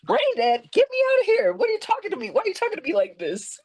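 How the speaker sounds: very high, frantic mock outburst; imitated caged animal. Patient reenacting waking restrained in hospital, frantic and indignant. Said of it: "caged animal".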